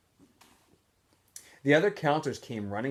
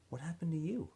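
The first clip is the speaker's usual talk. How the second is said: cool warden voice; 6 semitones higher, 7 dB quieter than usual. Acted. Warden questions Stanley.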